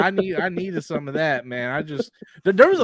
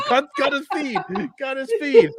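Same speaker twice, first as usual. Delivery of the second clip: laughing; 7 semitones higher than usual. Real, not acted. Laughing about dropped feed.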